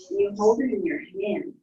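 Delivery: ordinary delivery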